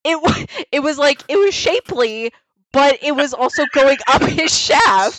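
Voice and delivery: worked-up, incredulous retelling; animated disbelief. Real, not acted. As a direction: pile on the gross detail with glee. Laughing disbelief at hair.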